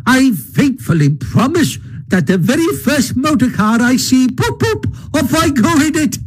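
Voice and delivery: high-pitched gleeful defiance; emphatic Toad. A narrator's reading. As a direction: vow it grandly, rising with relish. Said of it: "replied Toad emphatically".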